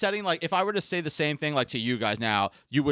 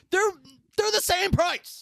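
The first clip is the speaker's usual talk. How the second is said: high, drawn-out complaint; exasperated disbelief; slower than usual. Real, not acted. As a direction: protest it in slow, high-pitched disbelief. Complains that prices never dropped.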